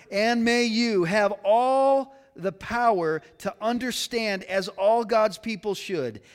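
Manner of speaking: high, measured preacher's proclamation; emphatic scripture reading. Real, not acted. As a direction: proclaim the verse slowly over the congregation. Reads scripture at full force.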